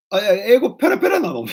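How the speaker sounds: high, loud, rapid disbelief; hammed-up amazed question; astonished. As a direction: blurt it out in comic shock. Acts his astonished reaction to fluency.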